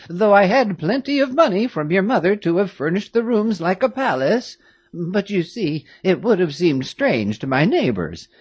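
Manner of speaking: put-on a doting old servant, amazed; old woman greeting the girls; old nurse. A narrator's reading. Old nurse Louise voiced.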